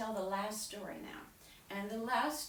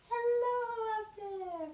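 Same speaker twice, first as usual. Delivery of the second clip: calling up high; 14 semitones higher, 4 dB louder than usual. Shown as a demonstration. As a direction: call up to someone very tall. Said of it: "so you go".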